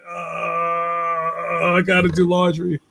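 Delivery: reluctant, hammed-up whine; mock dread. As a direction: groan it out, putting off the chore. Acts out dreading laundry chore.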